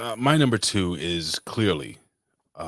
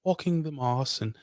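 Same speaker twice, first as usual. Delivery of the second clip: mock English accent; 11 semitones higher than usual. Host playing the Queen of England in a mock English accent. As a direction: play the Queen with a hammed-up high English accent. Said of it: "I can't do a real English accent".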